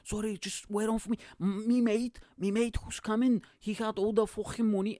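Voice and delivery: high, put-on Scouse lad voice; Scouse accent. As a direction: make excuses in a hammed-up Scouse accent. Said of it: "with the craziest Scouse accent".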